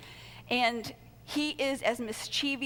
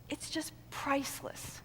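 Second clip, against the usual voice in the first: hushed sincere; 3 semitones higher, 8 dB quieter than usual. Real, not acted. Tender praise of volunteers.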